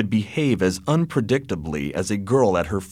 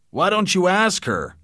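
demanding; 9 semitones higher than usual. A narrator's reading. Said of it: "demanded old Anthony".

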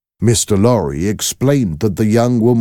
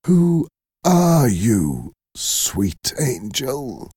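tender wonder; 6 semitones higher, much slower than usual. Acted. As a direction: ask slowly in hushed wonder. The father wonders at Lucy.

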